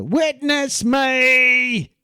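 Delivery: high, drawn-out shout; loud, stretched-out film-quote holler; movie yell. Retold or imitated. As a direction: yell "Witness me" as a long battle cry. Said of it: "that phrase that they yell in Fury Road".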